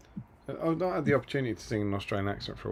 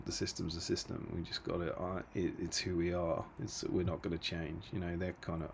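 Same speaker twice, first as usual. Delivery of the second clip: Ange Postecoglou impression; 4 semitones lower, 8 dB quieter than usual. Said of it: "a kind of Ange Postecoglou kind of".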